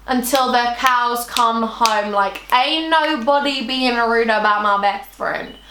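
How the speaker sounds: slow, put-on sassy voice; slow, emphatic loyal declaration; drawn-out defiance. Real, not acted. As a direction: stretch each word, standing up for a friend. Boasts of defending her friend.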